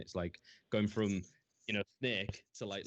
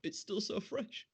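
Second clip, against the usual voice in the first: mock grief; 13 semitones higher, slower than usual. Mock grief, high slow voice.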